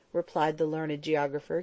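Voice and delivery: ordinary delivery, nothing marked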